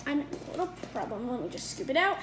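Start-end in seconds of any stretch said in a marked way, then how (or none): none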